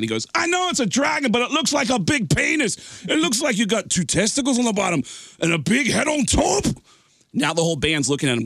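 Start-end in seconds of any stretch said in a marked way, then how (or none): 0.3-6.6 high, insistent rock-singer voice. Retold or imitated. Singer insisting to the drummer, high voice. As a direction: push the point, hammed-up.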